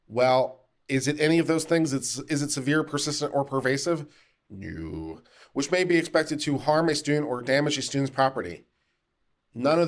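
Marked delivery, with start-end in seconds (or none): 4.5-5.2 low, quiet, dismissive answer; deadpan no. Shown as a demonstration. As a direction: drop it low and soft, dismissing the question. Deadpan answer to own question.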